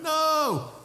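a sudden, very high, frightened "No!"; terrified cry. Retold or imitated. Overwhelmed by God's holiness, he cries out in terror. Sudden fear of holy God.

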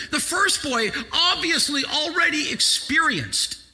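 high, loud, shouted preaching; preaching at full force. Acted. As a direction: drive it home to the congregation. Preaching climax.

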